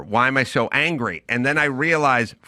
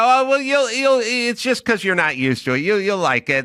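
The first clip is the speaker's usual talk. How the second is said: higher, eager comforting tone; soothing reassurance; 8 semitones higher than usual. Retold or imitated. To a friend with a bad haircut, sugary reassurance. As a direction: coax them into liking it. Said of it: "And I'd go".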